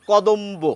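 কদম্ব with the b clearly kept, kodombo; কদম্ব: ko-dom-bo; slow careful কদম্ব. Shown as a demonstration. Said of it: "আমরা বলি".

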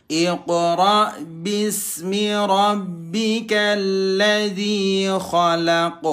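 slow chanted tajwid reading; Quran recitation. Shown as a demonstration. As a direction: recite clearly, bounce every q. (bacaan Al-Quran pelan dan jelas)

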